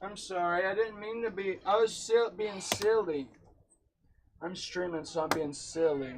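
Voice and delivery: very soft, soothing voice; soft apology. Real, not acted. Father apologizes to his tired son, softly soothing. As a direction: hush down and comfort gently.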